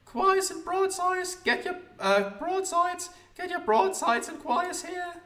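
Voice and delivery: put-on very high, slow street hawker boy; the newsboy calls out his papers, piping high. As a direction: cry the papers like a little newsboy. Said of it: "the newsboy, let's talk to him".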